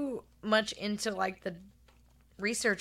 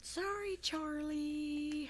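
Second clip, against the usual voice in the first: high sing-song; 8 semitones higher, 8 dB quieter, much slower than usual. Acted. Playful catchphrase, high.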